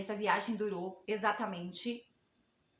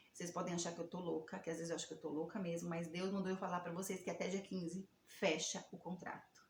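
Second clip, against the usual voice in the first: nervous confession; 3 semitones lower, 7 dB quieter than usual. Retold or imitated. Telling the men, fighting tears.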